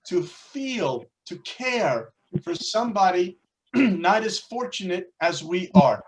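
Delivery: ordinary delivery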